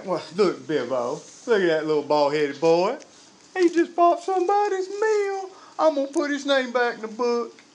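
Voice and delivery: folksy God rising to a high pitch; delighted mock deity, climbing high on the good deed; comic voice of God. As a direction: play an easily pleased God, not deep at all. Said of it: "I'm thinking he has a little deeper voice".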